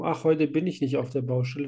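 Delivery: ordinary talk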